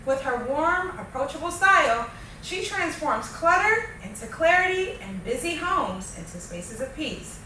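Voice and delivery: upbeat, polished show opener; podcast host intro. Acted. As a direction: read it like a show host welcoming listeners.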